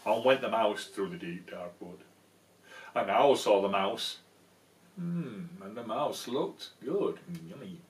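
cosy picture book reading; storybook narration. A narrator's reading. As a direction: read it like a bedtime story.

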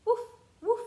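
high, bright-sounding yappy puppy woof; puppy bark. Acted. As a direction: bark like a little playful puppy. Dog barks in picture book.

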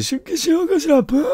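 mother sobbing comically with streaming tears; bawling mother. Retold or imitated. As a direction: blubber it like a cartoon crybaby. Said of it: "お母さんも息子も号泣してるんですよ".

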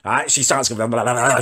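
ordinary talk, nothing marked

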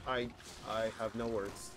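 ordinary delivery

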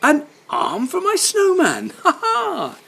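put-on high laughing child voice; laughing boy. Boy finding an arm for his snowman, laughing. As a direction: chuckle it out gleefully. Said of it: "he says with a laugh".

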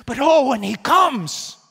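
"when he comes" cried out loud and high; fervent cry. Acted. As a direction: lift "comes" with full fervor. Preaching climax.

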